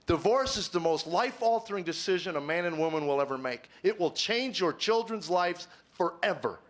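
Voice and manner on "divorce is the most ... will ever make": normal talking voice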